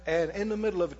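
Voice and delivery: normal talking voice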